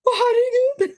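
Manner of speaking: a squeal of surprise with a laugh; sister's shocked delight. Retold or imitated. Sister hearing about the national team selection, laughing squeal. Sister reacting to big news.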